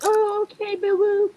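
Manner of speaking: very high, soft falsetto cooing; baby someone in a tiny mock-sweet voice; squeaky, soothing mimicry; placating partner. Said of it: "Then everybody's like".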